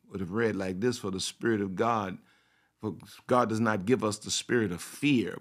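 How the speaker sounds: ordinary talk, nothing marked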